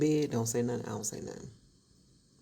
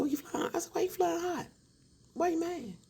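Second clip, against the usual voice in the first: very high, teasing why you mad; wife mocks husband for getting mad; mocking taunt. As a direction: needle him in a sing-song.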